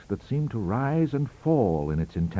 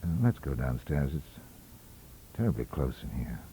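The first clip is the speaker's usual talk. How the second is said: shaken murmur; 8 semitones lower, 7 dB quieter than usual. Retold or imitated.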